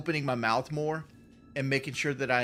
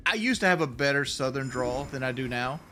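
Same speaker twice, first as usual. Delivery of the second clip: ordinary talk, nothing marked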